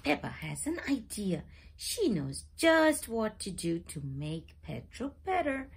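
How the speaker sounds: ordinary delivery, nothing marked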